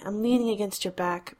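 plain reading voice

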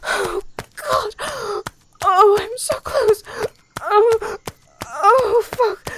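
breathless moans building up, voice cracking; moaning. Acted. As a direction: let the moans climb, gasping between words.

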